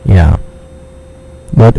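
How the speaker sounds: usual speaking voice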